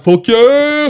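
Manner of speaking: high, loud whooping cheer; excited cheer. Retold or imitated. Recalling his reaction to Alien Resurrection, excited high cheer. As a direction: belt it out high like a delighted fan.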